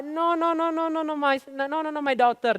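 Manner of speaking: high, softening string of no's; reassuring mother. Acted. Mother denying it to her daughter, high cooing reassurance. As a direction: coo the denials high, easing down. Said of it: "dia bilang kan".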